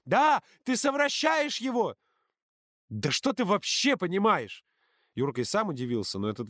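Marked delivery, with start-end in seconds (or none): 0.0-2.0 very high-pitched shouting; furious screeching tirade; screamed accusation. A narrator's reading. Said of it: "закричала она". 2.9-4.6 high, slow, emphatic challenge; indignant raised voice, words stretched; angry retort. A narrator's reading. Shouted retort in quarrel.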